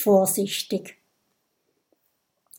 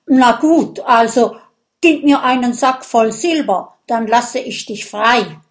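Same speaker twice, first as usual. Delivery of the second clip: loud, higher blustering Viking; gruff Viking; 3 semitones higher, 6 dB louder than usual. Acted. Viking tries to bargain with the dragon, blustering loud. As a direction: bluff a bargain like a pompous warrior.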